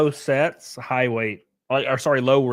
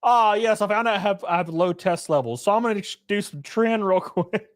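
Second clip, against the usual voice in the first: mock imitation; 7 semitones higher than usual. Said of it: "He did Trin because he said".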